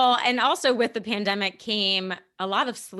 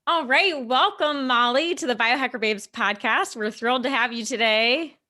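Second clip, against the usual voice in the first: podcast intro; 3 semitones higher, 4 dB louder than usual. Real, not acted. Podcast host opening the show.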